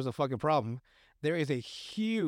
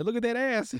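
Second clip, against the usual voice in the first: mock call; 8 semitones higher than usual. Mooning scene, mock call.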